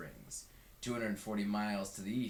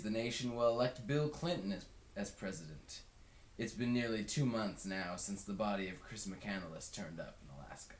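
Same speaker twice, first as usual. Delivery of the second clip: ordinary delivery, nothing marked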